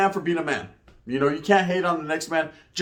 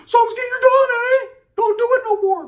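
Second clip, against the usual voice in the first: very high, louder, squeaky mock voice; mocking falsetto; 5 dB louder than usual. Mocking haters taunting a punked rapper.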